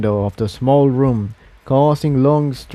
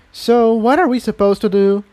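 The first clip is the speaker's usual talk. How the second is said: higher mare voice; 10 semitones higher than usual. A narrator's reading. Incendia voiced by narrator.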